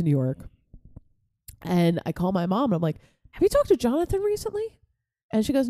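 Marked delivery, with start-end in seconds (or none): 3.3-4.6 high, quick casual question; acting innocent on the phone; feigned innocence. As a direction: set up a prank, pitched way up. Retold innocent setup of a prank.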